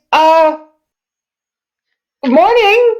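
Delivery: high, polite innkeeper voice. Acted. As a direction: greet her like a friendly innkeeper. GM plays the innkeeper.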